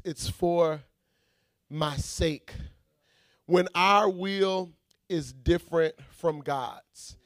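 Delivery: usual speaking voice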